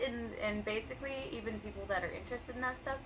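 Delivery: ordinary talk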